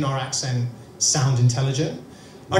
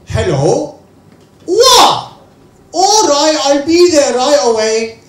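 loud, much higher, hammed-up shock on the phone; fake phone call; 9 semitones higher, 10 dB louder than usual. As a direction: overact a fake emergency call. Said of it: "acts like his phone is ringing".

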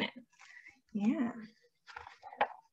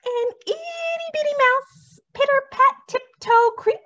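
high tiny voice; 8 semitones higher, 5 dB louder than usual. A narrator's reading. Tiny mouse tiptoeing.

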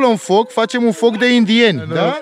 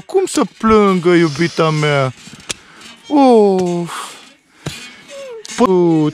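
usual speaking voice

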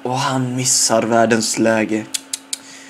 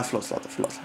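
sheepish whisper; 5 semitones lower, 14 dB quieter, faster than usual. Retold or imitated. Apologising to Zlatan after missing.

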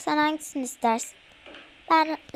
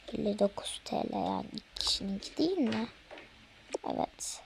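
whispering; 4 semitones lower, 8 dB quieter than usual. Acted. Asking the price aside.

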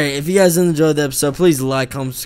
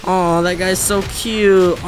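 cooing; 5 semitones higher, slower than usual. Real, not acted. Cooing at a small creature.

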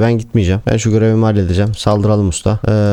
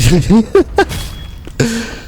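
high villain cackle; 11 semitones higher than usual. Acted. Gamer gloats over Radagos with a high villain cackle.

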